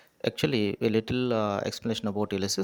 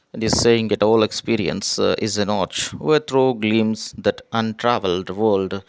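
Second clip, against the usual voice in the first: louder declaiming of verse; poem recitation; 5 dB louder than usual. Acted. Teacher declaiming poem aloud to students, louder recitation. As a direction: proclaim the lines to the class.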